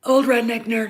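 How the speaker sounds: ordinary delivery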